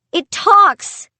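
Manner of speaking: high, loud cry of amazement; startled outburst, voice shooting up; shocked gasp. Acted. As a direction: exclaim it in astonished alarm. Gasp as puppet speaks.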